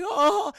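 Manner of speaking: very high, loud hammed-up "oh"; mock gasp. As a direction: exclaim as if overcome. Beast overcome by Belle's return.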